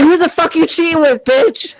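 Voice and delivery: high mock jealous yell; retold yelling. Boyfriend accuses girlfriend of cheating, mock yelling. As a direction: act out a boyfriend's angry accusation. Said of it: "he just started yelling at me".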